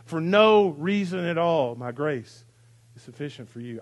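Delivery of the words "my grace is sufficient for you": soft, low and intimate; hushed voice of God. Acted. Pastor voicing Jesus speaking grace to Pol Pot, hushed. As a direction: say it gently, almost to yourself. God's words to Charles Manson.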